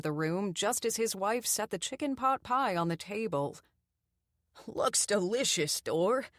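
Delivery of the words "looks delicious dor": put-on old man pleased. A narrator's reading. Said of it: "he exclaimed".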